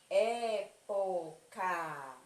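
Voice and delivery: Época very slowly, syllable by syllable, leaning on "É"; stress on first syllable. Shown as a demonstration. Teacher demonstrating proparoxytones, stress on the "É" of Época. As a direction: spell out Época in long syllables, strongest first. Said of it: "que serão a antepenúltima sílaba".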